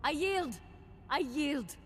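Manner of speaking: high, frantic cry of surrender; panicked surrender. Acted. Beaten Sith begging the Jedi to stop, high and frantic. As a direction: beg them to stop, beaten.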